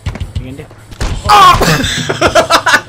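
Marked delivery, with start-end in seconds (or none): 1.3-2.9 sudden high loud yelp; loud startled cry; loud surprised oh. Real, not acted. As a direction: blurt out a shocked oh. Exclamation at a crash.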